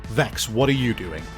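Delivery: ordinary talk, nothing marked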